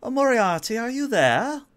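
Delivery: high, loud sultry countess calling. Acted. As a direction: call out sweetly as the Countess over comms. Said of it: "And the Countess says".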